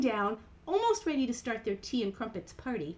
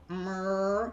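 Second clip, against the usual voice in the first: long low moo; cow moo; 3 semitones lower than usual. Acted. Storyteller shows the cow sound, low drawn-out moo. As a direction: chin to chest, moo deep and long.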